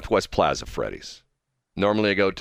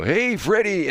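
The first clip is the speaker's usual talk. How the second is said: higher, called-out order announcer; microphone announcer impression; 11 semitones higher, slower than usual. Host mimicking the Freddy's guy on the microphone, sing-out call. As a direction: imitate the counter guy calling a customer. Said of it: "that's the guy on the microphone".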